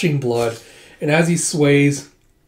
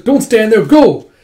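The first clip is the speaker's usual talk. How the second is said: high, loud shouted order; urgent command; 7 semitones higher, 9 dB louder than usual. Acted. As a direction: urge them to flee. Hit Zeno urges escape.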